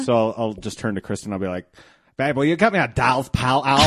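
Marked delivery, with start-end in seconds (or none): 2.2-3.9 loud, higher, hammed-up British accent; bad British accent. Host jokingly asking partner for a beer, bad British accent. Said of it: "has a bad British accent".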